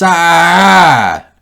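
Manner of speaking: loud high cry of frustration; frustrated yell. Real, not acted. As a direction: let out an exasperated shout. Frustrated cry mid-rant.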